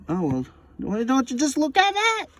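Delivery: high amused surprise; mock delight. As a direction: act pleasantly shocked.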